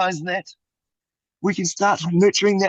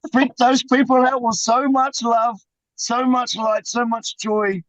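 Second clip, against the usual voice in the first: energized urging; 6 semitones higher, slower than usual. Real, not acted. Urging love, rising energy.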